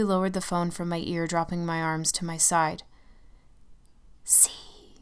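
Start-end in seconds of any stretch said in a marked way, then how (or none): 4.2-4.9 eerie shadow whisper; dark whisper behind you. Acted. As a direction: hiss it softly from behind. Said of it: "the shadow whispered".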